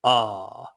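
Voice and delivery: aw held low and open; low open aw vowel; low drawn aw. Shown as a demonstration. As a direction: open the throat on aw. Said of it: "just say the word aw".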